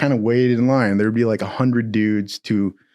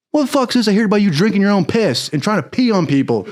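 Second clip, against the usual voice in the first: fast, raised chewing-out; angry chief; 8 semitones higher, faster than usual. Retold or imitated. Chief chewing out the recruit about the piss, fast and angry. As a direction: rip into the recruit, quick and higher. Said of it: "he goes".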